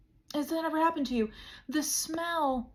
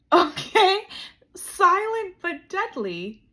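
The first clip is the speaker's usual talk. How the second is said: high, loud and slow punchline; 5 semitones higher, 7 dB louder, slower than usual. Acted. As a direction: land it big, stretching the words. Punchline shouted for effect.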